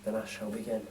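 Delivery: plain reading voice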